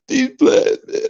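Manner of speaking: very high-pitched whiny crybaby imitation; mock them bawling in a squeaky falsetto; hammed-up tearful falsetto; mock crying. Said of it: "cry in that goddamn corner".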